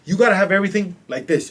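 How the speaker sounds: normal talking voice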